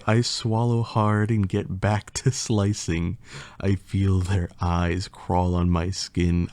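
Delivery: usual speaking voice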